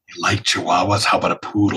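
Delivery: a hint of a low creepy tempter; lightly voiced sly monster; snooty poodle voice. Answers as the summoned monster.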